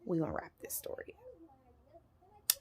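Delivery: slow, hushed whisper; whispered aside. Real, not acted. Storyteller to viewers about wrapping up, whispered. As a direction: whisper it under your breath, drawn out.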